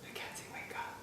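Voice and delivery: soft breathy whisper to a sleeper; mock whisper. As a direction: whisper to wake a sleeping friend.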